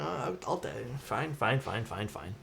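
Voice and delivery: grudging surrender, trailing off; resigned giving in. Real, not acted. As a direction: give up the argument with a sigh in the voice. Giving in to chat's correction.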